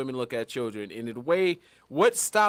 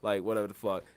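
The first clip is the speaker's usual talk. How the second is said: much softer and lower, shrugging it off; indifferent murmur; 9 semitones lower, 8 dB quieter than usual. Retold or imitated. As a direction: mumble it like you couldn't care less. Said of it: "it's just like".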